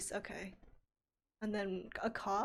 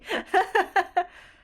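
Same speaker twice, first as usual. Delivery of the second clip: loud laugh; 10 semitones higher, 14 dB louder than usual. Real, not acted. Laughing at odd result.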